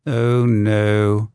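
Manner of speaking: a mild, understated fierce protest. A narrator's reading. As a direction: push back firmly but without shouting. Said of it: "the Astronomer Royal said fiercely".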